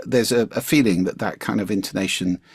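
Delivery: normal talking voice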